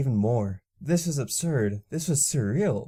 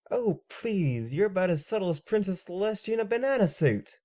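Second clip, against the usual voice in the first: teasing scoff; 8 semitones higher than usual. Acted. Mocking her subtlety.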